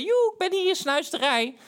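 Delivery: high, hailing "yoo-hoo" call; acted high-voiced hail; high-pitched calling out. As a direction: call out to someone passing, voice way up. Calls out to passing date.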